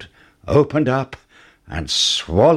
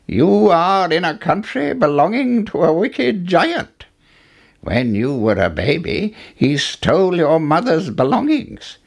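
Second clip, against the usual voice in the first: put-on old man voice; 7 semitones higher than usual. A narrator's reading. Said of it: "he said".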